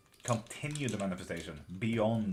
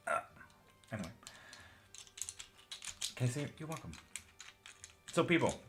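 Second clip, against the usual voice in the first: ordinary delivery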